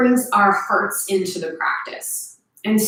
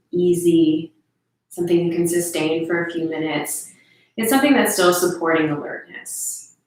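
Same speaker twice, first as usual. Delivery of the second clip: guided meditation; 2 semitones lower than usual. Real, not acted. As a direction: gently guide listeners through their senses.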